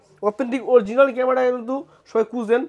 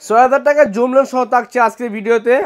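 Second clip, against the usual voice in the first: hype announcement; 6 dB louder than usual. Real, not acted. Vlogger announcing the headline deal.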